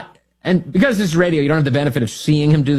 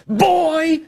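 high mock yell of boy; 12 semitones higher than usual. Host imitating the activist yelling at an officer, a high mock yell. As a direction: bark out Boy high and sharp. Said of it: "He's yelling".

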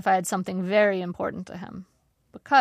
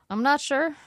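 usual speaking voice

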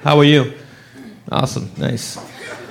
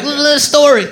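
high put-on voice; mock amazed self; quoted self; 8 semitones higher than usual. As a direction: blurt it out in a higher, odd voice. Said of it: "I'm like".

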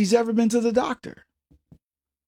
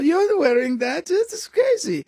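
mock snob ridicule; 6 semitones higher than usual. Imitating Anna Delvey mocking people's clothes, high snobbish. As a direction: look down your nose, pitched way up. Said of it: "she would ridicule people".

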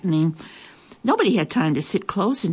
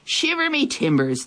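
pirate voice; 8 semitones higher than usual. A narrator's reading. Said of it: "the pirate said".

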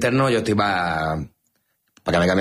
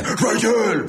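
strained inward-sucked voice, pitched up; Batman; 13 semitones higher than usual. Retold or imitated. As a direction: imitate the meme hero, talking inwards. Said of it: "que Batman habla [...] hacia adentro". (imitación forzada, hablando hacia adentro)

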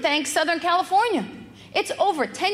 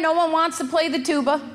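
ordinary talk, nothing marked

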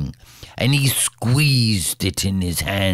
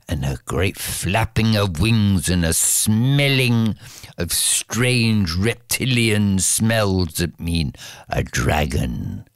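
plain reading voice

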